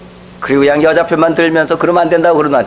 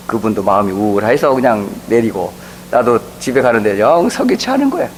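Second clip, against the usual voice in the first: ordinary delivery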